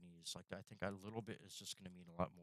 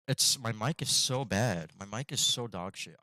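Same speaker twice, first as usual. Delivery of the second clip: frustrated; 2 semitones higher, 15 dB louder, slower than usual. Real, not acted. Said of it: "I apologize for my mic".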